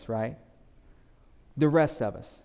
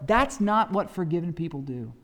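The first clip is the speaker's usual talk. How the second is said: usual speaking voice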